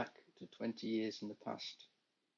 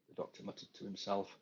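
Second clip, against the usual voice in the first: ordinary delivery, nothing marked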